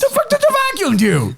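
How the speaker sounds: high-pitched incredulous outburst; mock outrage. Mock outrage over useless vacuum.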